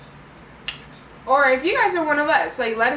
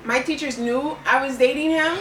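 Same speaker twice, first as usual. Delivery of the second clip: ordinary talk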